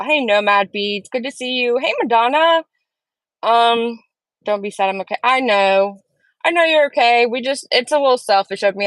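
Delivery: ordinary talk, nothing marked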